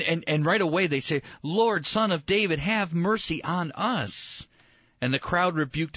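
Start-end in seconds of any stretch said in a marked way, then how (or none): 1.4-4.5 high, drawn-out plea for mercy; imitated beggar's cry; acted crying out. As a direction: call out to the Lord, stretching the final words. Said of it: "they shouted all the louder".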